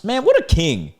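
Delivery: high, impressed exclamation; gushing admiration. Real, not acted. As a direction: cheer him on in a high voice. Admiring reaction to the boyfriend.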